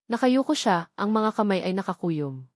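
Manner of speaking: ordinary delivery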